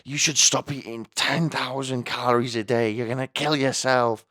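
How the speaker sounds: high, loud whiny mum; mum's nagging voice. Retold or imitated. Mum nagging her son about eating, high and loud. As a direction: whine like a worried nagging mother. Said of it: "when they're getting at me".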